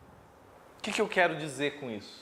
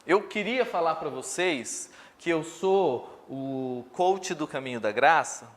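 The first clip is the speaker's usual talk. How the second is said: usual speaking voice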